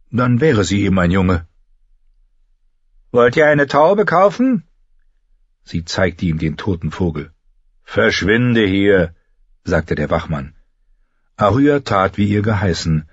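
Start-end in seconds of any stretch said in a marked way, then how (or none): 3.1-4.6 put-on higher child's voice offering a dead pigeon; a small girl pretending to be a boy; girl's voice. 7.8-9.1 slow, drawn-out brush-off; mildly voiced guard shooing her away; dismissive guard.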